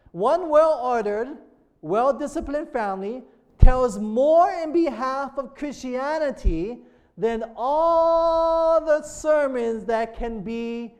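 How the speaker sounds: loud, slow, stretched-out preacher's reading; preacher proclaiming a quotation; emphatic sermon reading. Real, not acted. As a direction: read it out weightily, drawing out the key words.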